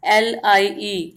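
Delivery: slow letter-by-letter spelling of एलआईई; spelled out. Shown as a demonstration. Teacher spells the example word for students, slowly letter by letter. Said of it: "example देखेंगे".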